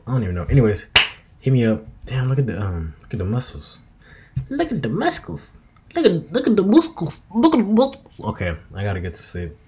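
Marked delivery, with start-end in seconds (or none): 4.5-8.2 much higher, squealing voice; high-pitched admiring squeal. Acted. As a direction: squeal it in delight.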